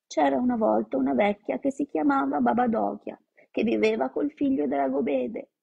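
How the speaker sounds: put-on a small boy chanting the rote story in the cold; reciting fairy tale. Leo forced to recite Olga's fairy tale, childlike rote recitation. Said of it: "costretto a recitare la solita fiaba".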